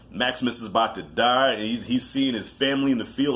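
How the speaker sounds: usual speaking voice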